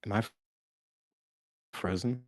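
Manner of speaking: normal talking voice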